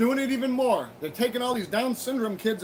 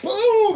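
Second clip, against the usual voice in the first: loud, sharp mock blast; sudden booming imitation of an explosion; 12 semitones higher, 9 dB louder than usual. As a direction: shout boom like the bomb hitting.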